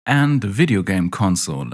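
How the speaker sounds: ordinary delivery, nothing marked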